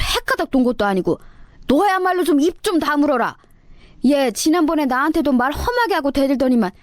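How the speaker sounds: a mother-in-law snapping at her daughter-in-law; scolding mother-in-law. Acted.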